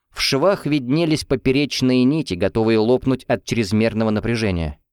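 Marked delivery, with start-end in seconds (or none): none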